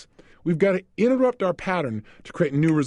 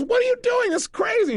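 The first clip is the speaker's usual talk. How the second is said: much higher mock squeal of disbelief; imitating a woman laughing, high; laughing protest. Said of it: "she started laughing".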